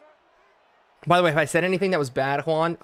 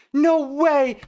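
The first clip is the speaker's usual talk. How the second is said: surprised; 10 semitones higher, 3 dB louder than usual. Real, not acted. Reacting to play.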